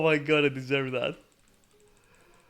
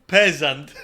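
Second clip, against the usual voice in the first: loud mocking jab through a laugh; mock insult; 11 dB louder than usual. Streamer mocking the game character, loud and laughing. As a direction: sneer it out, laughing.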